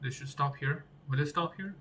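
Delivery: ordinary talk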